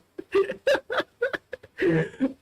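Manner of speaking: squeaky laugh breaking character; high laugh. Real, not acted. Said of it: "I was trying to do Paimon".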